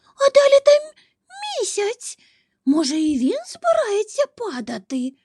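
very high-pitched, squeaky alarm; a small animal's panic, squeaked in broken phrases; frightened squirrel. A narrator's reading. Squirrel fears the moon will fall, frightened squeak. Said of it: "і зовсім злякалася".

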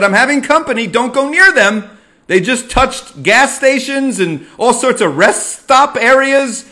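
loud urgent warning to keep away. Retold or imitated. Son warning his parents off the guest, loud and urgent. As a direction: raise the voice, urgent and insistent. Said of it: "I said".

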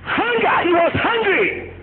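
normal talking voice

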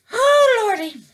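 high, loud excited cry; overjoyed squeal. Real, not acted. Crafter thrilled to start the name draw, squealing excitedly. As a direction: let it burst out of you, thrilled. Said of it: "Oh, my God, I'm so excited".

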